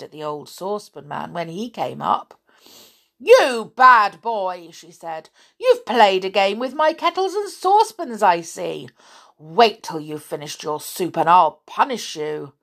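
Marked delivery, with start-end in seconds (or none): 3.2-4.7 loud, slow scolding; angry old woman. Acted. Old woman scolding the saucepan man, loud and slow. 5.6-12.5 put-on bossy old woman.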